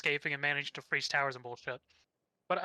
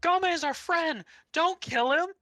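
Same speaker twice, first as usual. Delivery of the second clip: much higher mock pleading cry; pleading heroes; 11 semitones higher, 5 dB louder than usual. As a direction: parody the kids begging desperately. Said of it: "them going".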